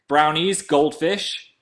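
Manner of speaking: ordinary talk, nothing marked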